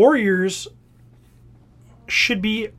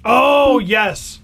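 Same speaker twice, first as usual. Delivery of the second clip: loud, high burst of delight; raised, delighted cheer; real excited recognition; 4 semitones higher, 6 dB louder than usual. Real, not acted. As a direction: exclaim it as the reference clicks. Delighted reaction to co-host's beeping.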